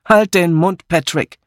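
mildly cross order to shut up; snapping. Acted. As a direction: cut him off crossly. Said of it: "fuhr Miss Blacklock ihn an".

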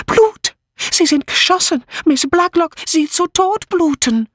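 high hysterical foreign woman; horrified gasping. A narrator's reading. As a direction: cry it in panic, very high. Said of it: "keuchte sie". (hysterisch und hoch gekeucht)

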